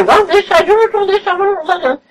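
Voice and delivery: mock her ordering the helper about; bossy invalid. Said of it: "she's ordering me".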